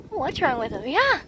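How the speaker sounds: normal talking voice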